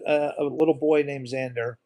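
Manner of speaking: normal talking voice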